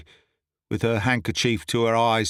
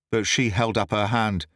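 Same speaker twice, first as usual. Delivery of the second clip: usual speaking voice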